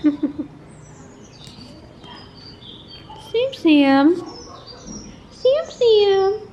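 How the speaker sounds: calls his name high and loud, rising each time; high cat call. Real, not acted. Owner calls her cat Sam Sam in a high, loud voice. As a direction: sing the cat's name up high and loud to draw him over.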